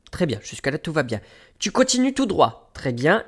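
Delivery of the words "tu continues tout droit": high-pitched imitation of a guide; lady giving directions. Station lady guiding a lost traveller, high mimicked voice. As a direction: mimic her instruction, pitched well up. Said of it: "Elle me dit".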